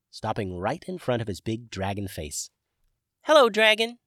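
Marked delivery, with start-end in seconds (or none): 3.2-4.0 tiny high squeak, loud and bright; little forest sprite squeaking a greeting; squeaky sprite. A narrator's reading. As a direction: squeak it out like a lemon-sized fairy. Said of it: "squeaked the sprite".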